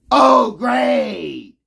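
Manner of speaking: sarcastic crying shout. Real, not acted. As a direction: scream it in fake joy. Sarcastic outburst at critic.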